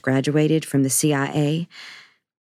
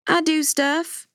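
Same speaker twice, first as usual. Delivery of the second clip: defensive retort; 13 semitones higher, slower than usual. Acted. Defensive, hands on hips.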